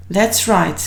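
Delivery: usual speaking voice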